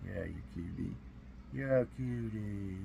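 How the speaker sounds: soft pet talk to the kitty; cooing to cat. Real, not acted. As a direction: sweet-talk the cat gently.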